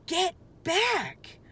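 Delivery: high urgent warning shout. A narrator's reading. Jack warning Annie away from the dinosaur, shouting. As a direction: yell it to stop her. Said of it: "said Jack".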